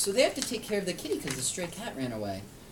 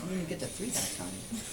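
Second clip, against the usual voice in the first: plain reading voice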